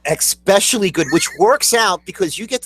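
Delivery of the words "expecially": especially high, as ex-SPESH-ully; wrong especially. Shown as a demonstration. Co-host echoing the mistake, high ex-SPESH-ully for especially. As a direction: put an X in especially, pitched up. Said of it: "not ex specially".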